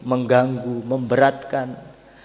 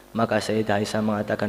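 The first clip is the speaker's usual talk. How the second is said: ordinary talk, nothing marked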